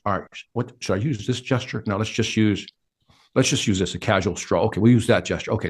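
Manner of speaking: quietly rehearsing a gesture alone on the stairs; talking to himself. Retold or imitated. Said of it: "talking to myself".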